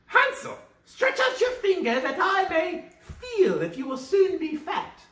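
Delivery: high loud witch voice; witch calling. Acted. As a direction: croon it like a witch luring Hansel. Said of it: "crept to the little stable and cried".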